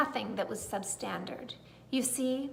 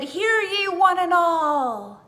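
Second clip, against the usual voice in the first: loud, slow, higher herald call; big proclamation; 5 semitones higher, 9 dB louder, slower than usual. A narrator's reading. Little old lady summoning the whole garden, booming. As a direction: call it out like a town crier. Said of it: "called out in a voice that was astonishing".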